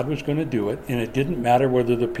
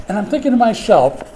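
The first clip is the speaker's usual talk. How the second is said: normal talking voice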